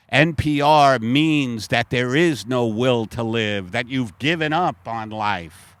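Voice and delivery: usual speaking voice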